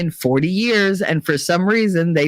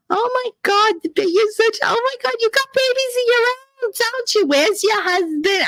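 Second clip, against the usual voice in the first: put-on gushing old aunt. Elderly aunt greeting niece at the wake, high gushing. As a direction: coo over her like a long-lost niece. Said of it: "she was like".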